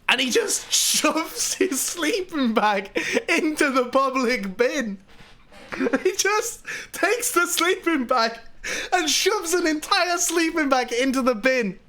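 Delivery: high, laughter-choked telling; real laughing fit. Real, not acted. Telling of the bag in the bin, cracking up. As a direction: fight through giggles to get it out.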